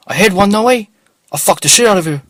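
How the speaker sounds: high, loud and pushy; loud street stranger. Retold or imitated. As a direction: fire the question up high and loud. Stranger brags.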